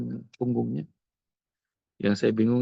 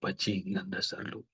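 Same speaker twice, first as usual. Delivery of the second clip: inner thought; 3 semitones lower, 10 dB quieter than usual. Retold or imitated. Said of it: "Dalam hati saya".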